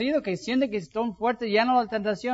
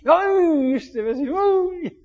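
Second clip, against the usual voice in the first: exasperated "ay", pitched up; mock annoyance; 6 semitones higher, 4 dB louder, slower than usual. Pastor mimics annoyance at neighbour taking his parking spot. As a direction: sigh it out at the rude neighbour.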